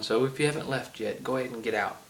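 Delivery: usual speaking voice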